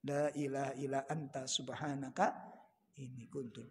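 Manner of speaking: soft, low chanted Arabic prayer; prayer recitation. Acted. Preacher reciting Yunus's prayer to congregation, soft and low. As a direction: recite it softly, like a whispered supplication.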